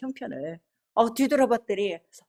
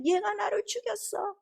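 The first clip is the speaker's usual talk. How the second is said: high, slow spirit voice; accusing spirit; 11 semitones higher than usual. Retold or imitated. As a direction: draw out the accusation in a high, put-on voice. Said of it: "그 귀신이 하는 말이". (높고 느린 귀신 목소리 흉내)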